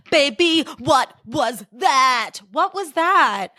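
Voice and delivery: high, slow, drawn-out astonishment; exaggerated disbelief. Podcaster quoting immortal words about an actor's accent, exaggerated disbelief. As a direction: stretch each word out, high and appalled.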